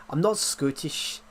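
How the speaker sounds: slow, drawn-out Scottish accent; put-on Scots, unhurried. Shown as a demonstration. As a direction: say it with a Scottish accent, stretching the vowels. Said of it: "I can do the accent though".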